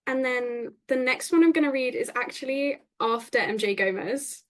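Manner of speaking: ordinary talk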